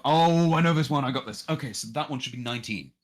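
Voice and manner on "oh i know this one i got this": loud, high burst of realisation; excited recognition. Real, not acted. Player spotting a familiar puzzle, excited outburst. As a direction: burst out as the answer clicks.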